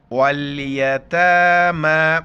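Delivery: very slow, loud chanted "यतामा", both aa held; stretched long vowels. Shown as a demonstration. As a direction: draw out "ता" and "मा" in "यतामा". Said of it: "एक अलिफ की मिकदार ऊपर खींचना है". ("यतामा" में ता और मा खींचकर)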